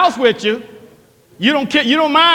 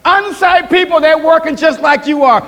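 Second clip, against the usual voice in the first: higher, stressing unsaved; preaching emphasis; 3 semitones higher than usual. Acted. Pastor warning the church about unsaved people, emphatic preaching. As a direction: lean hard on unsaved.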